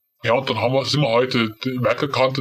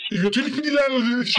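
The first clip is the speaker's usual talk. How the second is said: indignant protest; 8 semitones higher, faster than usual. Acted. Caller doubts he is Lionel.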